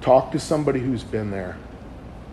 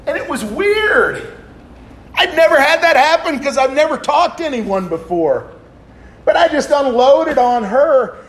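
high, loud laughing voice; 11 dB louder than usual. Real, not acted. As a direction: laugh your way through the relief. Laughing through the punchline.

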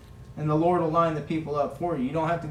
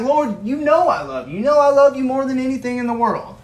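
higher and louder, earnest begging; pleading Peter; 4 semitones higher, 6 dB louder than usual. Acted. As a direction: plead your love with everything. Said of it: "Peter's like".

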